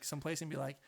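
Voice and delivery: ordinary talk, nothing marked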